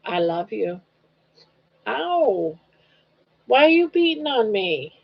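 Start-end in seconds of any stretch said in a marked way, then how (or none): 1.9-4.9 higher pitched pretend complaint to a pet; mock hurt whine. Owner pretending the dog hurt her, higher whiny plea. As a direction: whine at the dog like you're wounded.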